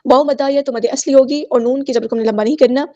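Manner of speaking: ordinary delivery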